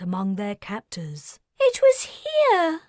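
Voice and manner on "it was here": very high, loud sobbing cry; distraught child. Acted. Mickey crying out in distress, very high child's voice. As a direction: cry out in grief. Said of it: "Mickey cried".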